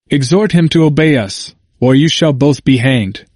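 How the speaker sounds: usual speaking voice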